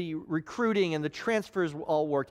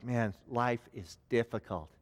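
glum; 8 semitones lower, 5 dB quieter than usual. Retold or imitated. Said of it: "take the mask off".

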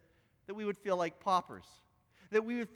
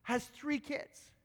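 angry lawyer; 4 semitones higher than usual. Retold or imitated. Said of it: "He said".

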